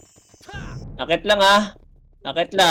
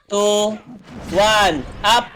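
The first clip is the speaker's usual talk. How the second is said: ordinary talk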